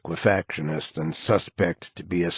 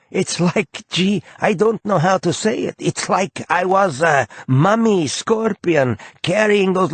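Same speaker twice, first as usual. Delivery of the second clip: higher, bashful gushing voice; shy aw-shucks; 10 semitones higher, 3 dB louder than usual. A narrator's reading. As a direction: fumble for words like a bashful boy. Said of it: "he says shyly".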